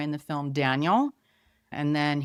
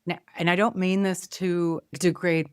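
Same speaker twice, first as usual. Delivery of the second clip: usual speaking voice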